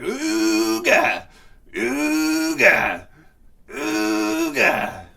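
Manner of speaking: imitated klaxon blaring ooga, high and loud; ooga alarm sound. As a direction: honk it out like a ship's alarm. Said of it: "the Star Trek alarm in my head".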